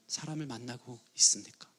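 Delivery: hushed pulpit whisper; whispered question. Real, not acted. Preacher drops to whisper.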